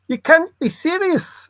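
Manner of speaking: a light, high-pitched take on McEnroe's outburst; a hint of McEnroe's indignant protest; McEnroe impression. Vlogger lightly quoting McEnroe's high protest. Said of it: "said Mister McEnroe".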